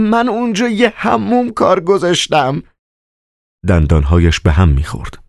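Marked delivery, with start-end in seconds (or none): 0.1-2.6 much higher, panicked cry; frightened yell. Acted. Bleeding Mogarych cries out to save himself. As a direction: plead in panic through the fear. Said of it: "فریاد زد".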